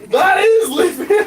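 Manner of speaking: high, slow, loud objection; loud protest. Pretend. Stuck player objects to being left, loud high protest. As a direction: wail the protest at the party.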